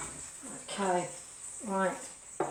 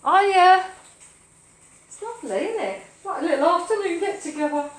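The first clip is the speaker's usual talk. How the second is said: bright high cheery hello; excited greeting; 7 semitones higher, 6 dB louder than usual. Real, not acted. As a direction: greet friends with delight. Greeting live chat viewers.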